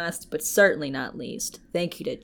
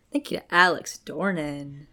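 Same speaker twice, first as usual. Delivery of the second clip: normal talking voice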